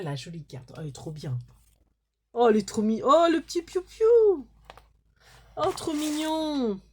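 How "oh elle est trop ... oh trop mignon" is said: higher, louder cooing over a tiny chick. Real, not acted. Vlogger cooing over the little chick cutout, raised and loud.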